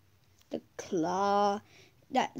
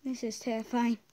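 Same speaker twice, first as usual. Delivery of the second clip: ordinary talk, nothing marked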